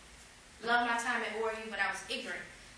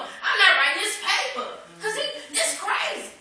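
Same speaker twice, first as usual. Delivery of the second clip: high, loud defiant refusal with a laugh; hammed-up tantrum over the paper; going off; 10 semitones higher, 9 dB louder than usual. To the church, reliving refusing the senior paper, loud defiance. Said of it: "I'm just really going off".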